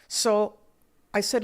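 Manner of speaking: ordinary delivery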